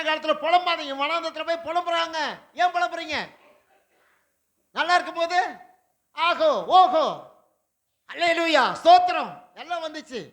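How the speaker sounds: loud, climbing sermon proclamation; fiery preaching. Acted. Pastor thundering to the congregation about the furnace, loud and fervent. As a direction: preach it out with rising fire.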